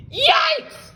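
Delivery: very high shrieked yelp; frightened scream. Acted. Webster spotting eyes in the cave, shrieking. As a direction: scream it in sudden terror. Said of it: "Webster screamed".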